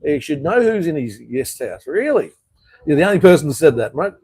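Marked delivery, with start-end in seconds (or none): 1.9-2.3 high incredulous Really; sarcastic high-pitched Really; mock disbelief. Real, not acted. Sarcastic retort to commenter. 2.8-4.1 louder, faster retort; rapid indignant jab; heated. Real, not acted. Arguing with commenter.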